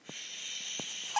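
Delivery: soft, drawn-out shh; shushing sound. Shown as a demonstration. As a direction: hush the class with a long shh. Teacher demonstrates the word for quiet.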